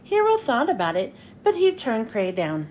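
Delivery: ordinary delivery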